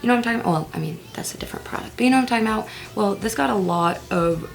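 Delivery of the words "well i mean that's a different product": quick whispered correction; whispered aside. Pretend. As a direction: mutter it under your breath.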